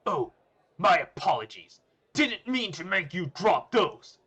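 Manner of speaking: very loud, high, drawn-out mock apology; big shouted character voice; booming voice. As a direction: boom it out at full volume. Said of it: "A loud booming voice".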